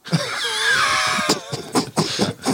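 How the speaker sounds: high squealing burst of laughter; cracking up. Real, not acted. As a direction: shriek with laughter at the innuendo. Cracks up at innuendo.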